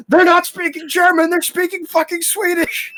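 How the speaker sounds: retelling a friend's gleeful realization; excited outburst. Retold or imitated. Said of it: "And then Alex just goes".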